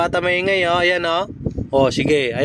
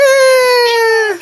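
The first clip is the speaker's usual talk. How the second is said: short squeal, much higher; high playful squeal; 6 dB louder than usual. Acted. Mother squealing playfully to her baby.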